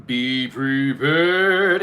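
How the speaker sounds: slow, drawn-out warning; ominous warning. Acted. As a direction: stretch it out like a threat.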